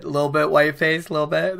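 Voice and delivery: ordinary talk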